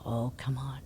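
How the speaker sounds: softly whispered pleading; whispered plea. Retold or imitated. Rider begging everyone to keep quiet so the horse stays calm, whispering. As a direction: whisper so the horse won't explode. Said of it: "and I'd go".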